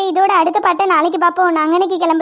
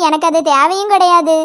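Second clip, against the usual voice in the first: normal talking voice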